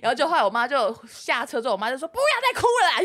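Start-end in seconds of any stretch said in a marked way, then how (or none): 2.1-3.0 much higher, louder scolding; exasperated mom. Retold or imitated. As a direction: scold the crying kid, fed up. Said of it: "因为我妈快被我气死了".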